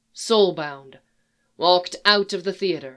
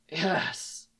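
murmured; 10 dB quieter than usual. A narrator's reading. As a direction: murmur it quietly. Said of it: "Geralt murmured".

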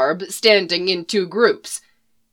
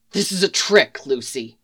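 a low, much softer growl; 7 semitones lower, 3 dB quieter than usual. A narrator's reading. Flynn warning Lucy of a trick, low growl. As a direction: grumble it darkly at Lucy. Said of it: "Flynn growled".

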